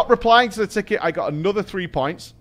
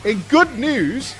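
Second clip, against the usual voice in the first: slow, higher, smug upbeat announcement; cheeky; 5 semitones higher, slower than usual. Retold or imitated. Husband to panicked partner, smugly upbeat. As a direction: sell the bad news as a win.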